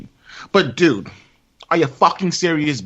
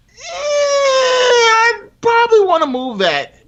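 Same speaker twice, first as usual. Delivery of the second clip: much higher, slow, louder wailing whine; mock whiny cry; 4 dB louder, slower than usual. Real, not acted. About moving someone's laundry, a mock whiny wail.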